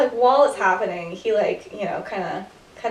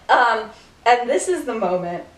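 ordinary delivery

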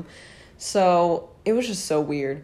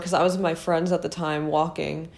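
ordinary delivery

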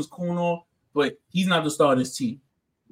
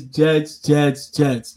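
ordinary talk

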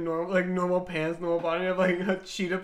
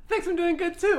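chirpy cashier; 11 semitones higher, 7 dB louder than usual. Retold or imitated. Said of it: "I always go".

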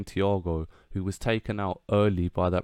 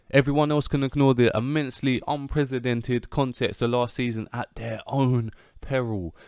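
usual speaking voice

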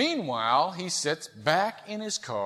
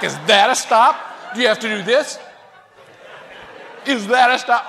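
loud, mock-outraged questioning; replayed indignant challenge; furious; 3 semitones higher, 10 dB louder than usual. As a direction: demand an answer, hammed-up and loud. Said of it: "Oh, I'm just furious".

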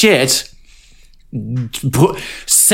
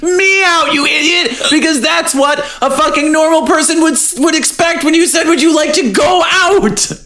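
rising, much higher mock rant that builds to a shout; exasperated high shout; 14 semitones higher than usual. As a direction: scold him like an idiot, higher and higher. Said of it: "And she's like".

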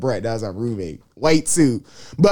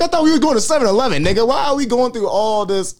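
higher-pitched retold complaint; mock exasperation; 7 semitones higher than usual. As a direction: replay an indignant protest, pitched up. Acted complaint to his friend.

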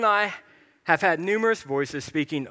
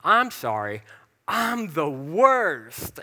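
slow, drawn-out mopey whine; wife's joking tagline in the car, slowly whined; mock self-pity; 4 dB louder, much slower than usual. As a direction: sulk it out playfully. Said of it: "she was like".

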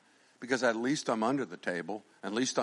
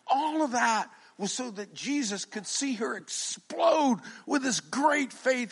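ordinary talk

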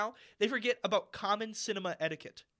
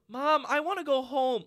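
raised, higher whine; loud child complaint; 6 semitones higher, 6 dB louder than usual. Retold or imitated. Little girl to mom halfway through film, loud whine. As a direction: imitate a kid announcing it to the whole room. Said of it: "as loud as she could say it".

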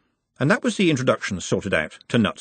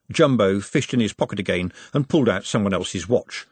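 plain reading voice

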